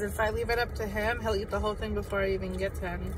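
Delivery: food at the lips; speaking around a bite; talking while eating. Real, not acted. Seen 1: Woman holds tot on fork at her mouth while speaking.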